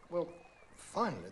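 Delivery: plain reading voice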